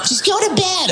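put-on girlfriend voice.

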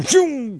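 mock engine zoom; zoom sound effect. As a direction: make the car zoom past. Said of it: "cut in front of him".

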